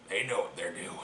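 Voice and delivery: ominous hush. Acted. Repeated ominous line.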